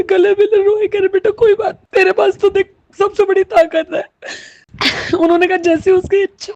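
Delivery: high, choked voice on the edge of sobbing; real tearful telling. Real, not acted. Mother recounting neighbours' embrace over her children, tearful. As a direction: keep talking through rising tears.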